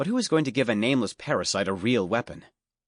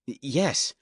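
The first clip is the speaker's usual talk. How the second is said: put-on higher, stammering nervous boy; nervous stutter; 4 semitones higher than usual. A narrator's reading. Scared rich boy stammers to stranger, nervous stutter. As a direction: stumble over the yes in fear. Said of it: "developed a bit of a stutter".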